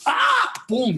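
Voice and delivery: loud high yell then boom; demo kiai shout. Shown as a demonstration. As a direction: shout it like a showy athlete. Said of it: "if I do like".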